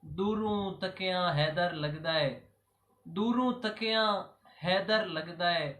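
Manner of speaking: rhythmic Punjabi elegy in short, paused phrases; poem recitation. Acted. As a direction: recite devotional verse line by line, letting each ending fall. (ਠਹਿਰ-ਠਹਿਰ ਕੇ ਸ਼ਾਇਰਾਨਾ ਅੰਦਾਜ਼ ਵਿੱਚ ਕਲਾਮ ਪੜ੍ਹਨਾ)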